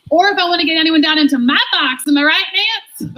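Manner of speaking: hammed-up jokey heckle; livening things up. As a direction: toss it out like a rowdy wisecrack. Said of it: "trying to liven things up".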